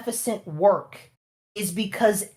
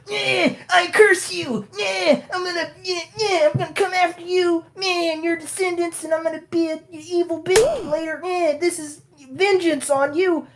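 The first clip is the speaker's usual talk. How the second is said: vengeful curse; 10 semitones higher than usual. Retold or imitated. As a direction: howl a curse like a witch. Villain voiced vengefully cursing.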